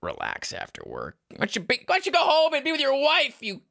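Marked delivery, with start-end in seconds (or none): 1.3-3.6 high shrill mock retort; mock the customer high and loud. Mocking dancer retort.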